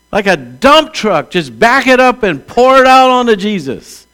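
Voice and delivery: high, loud, slow building sermon; full-force preaching. Acted. Pastor urging the congregation to ask God, preaching at full force. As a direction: shout it up to the rafters.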